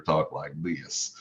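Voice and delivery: a touch of a Southern country accent. Shown as a demonstration. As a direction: slip into the country accent he grew up with. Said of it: "So I had a really strong country accent".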